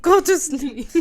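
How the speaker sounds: loud, high, slow mock Scottish; hammed-up Irish or Scottish hobo, half laughing; Irish or Scottish accent. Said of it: "He's Irish or something".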